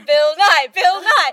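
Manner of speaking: loud laughing repeat of "Bill Nye"; cackling at the name mix-up. Real, not acted. Mocking the name slip.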